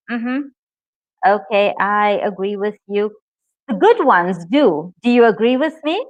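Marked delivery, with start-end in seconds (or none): none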